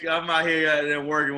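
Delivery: normal talking voice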